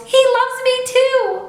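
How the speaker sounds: very high, loud squealing; a girl shrieking with glee. A narrator's reading. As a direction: keep squealing it out. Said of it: "she squealed".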